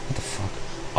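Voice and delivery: whispered aside. Real, not acted. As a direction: breathe it out unvoiced.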